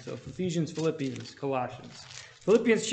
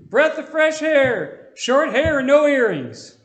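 high, loud hollering cook's voice, slower on the last words; yelled greeting; 9 semitones higher, 7 dB louder, much slower than usual. Retold or imitated. Cook hollering at a young soldier walking in, much higher and louder. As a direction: holler it across a diner like a cheerful old cook. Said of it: "He yelled from behind the kitchen".

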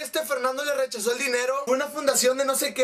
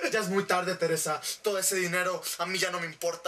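low, softer, weary man's voice; Fernando; 6 semitones lower, 5 dB quieter than usual. Retold or imitated. Fernando turns down Teresa's money, low and cold. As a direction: refuse in a put-on low voice. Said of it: "a devolverle el dinero a Fernando".

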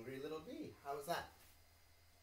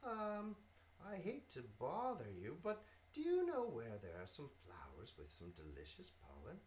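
polite bee voice; 4 semitones higher, 5 dB louder than usual. Acted. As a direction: ask sweetly, stretching excuse. Bee asks butterfly.

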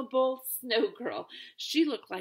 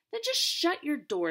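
higher and louder snappy retort; sharp retort; 6 semitones higher, 5 dB louder than usual. Acted. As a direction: tell her off, loud and high. Stink retorts to Judy.

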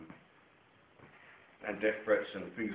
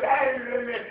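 calling out; 13 semitones higher, 8 dB louder, slower than usual. Retold or imitated. Son calling out in confrontation.

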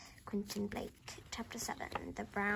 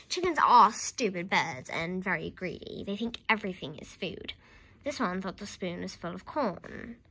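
ordinary delivery

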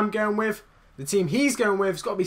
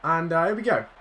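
ordinary talk

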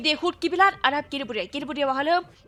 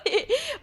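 high squealing laugh; 11 semitones higher than usual. Real, not acted. Laughs after being hit.

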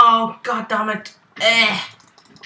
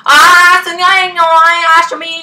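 loud, higher frantic cry; panicked yell; 9 semitones higher, 15 dB louder than usual. Real, not acted. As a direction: yell in sudden alarm. Attacked in game.

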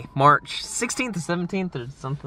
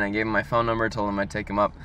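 ordinary delivery

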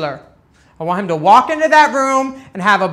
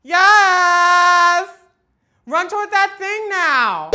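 cheerleader cheering; 9 semitones higher, much slower than usual. Pretend. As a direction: cheer her on from the sidelines.

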